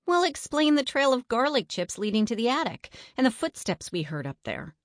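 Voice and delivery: demanding. A narrator's reading. Melody challenges doubting Eddie to explain the trail, demanding. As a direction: demand an answer. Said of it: "Melody demanded".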